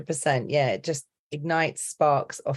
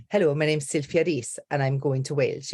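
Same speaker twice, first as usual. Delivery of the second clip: lilting Welsh accent. Shown as a demonstration. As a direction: introduce yourself in Welsh-accented English. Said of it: "with the Welsh accent".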